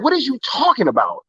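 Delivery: high, disbelieving exclaim; retold shock at a wild claim; incredulous retort. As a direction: pitch it up in disbelief. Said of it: "I'm like".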